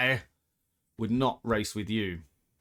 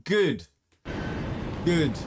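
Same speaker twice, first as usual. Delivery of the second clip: gleeful; 3 semitones higher than usual. Real, not acted. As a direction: bite it off with satisfaction. Gloating at the crash.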